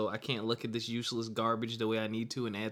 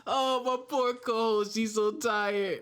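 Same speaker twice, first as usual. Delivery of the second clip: high, slow fake sympathy; host teasing his sleepy co-host with mock sympathy; mock pity; 10 semitones higher, slower than usual. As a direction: coo over her like a baby.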